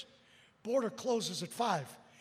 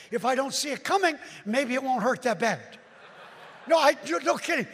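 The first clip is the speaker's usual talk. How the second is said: raised voice; 2 semitones higher, 7 dB louder than usual. Real, not acted. Excited telling.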